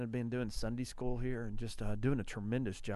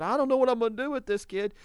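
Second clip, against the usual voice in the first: louder, higher, fast exasperated quote; exasperated mom; 9 semitones higher, 8 dB louder, faster than usual. Retold or imitated. Wife fed up with glitter-covered daughter, loud exasperation. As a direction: throw up your hands at the kid.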